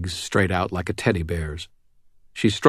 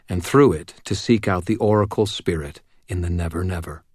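ordinary delivery, nothing marked

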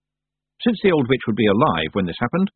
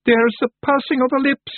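high, alarmed Feagle cry; panicked shout; 3 dB louder than usual. A narrator's reading. A Feagle warns of Tiffany's lips, shouting in panic. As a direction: shriek a warning of doom. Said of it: "a Feagle shouted".

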